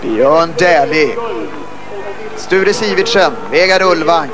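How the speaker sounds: usual speaking voice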